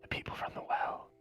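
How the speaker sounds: voiceless and hushed; secretive whisper. Acted. Nervous drinker names the danger to stranger, whispering. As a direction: let the secret out in breath only.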